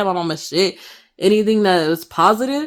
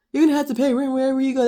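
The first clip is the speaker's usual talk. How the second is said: quick, higher nagging mom line; imitating mom; 6 semitones higher, much faster than usual. Son mimicking his mom's rent lecture, quick and higher. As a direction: rattle it off the way mom always says it. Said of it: "my mom loves to tell me".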